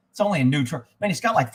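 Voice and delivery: usual speaking voice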